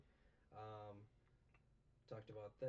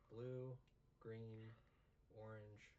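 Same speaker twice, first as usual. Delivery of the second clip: ordinary talk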